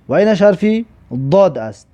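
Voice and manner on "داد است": ordinary talk, nothing marked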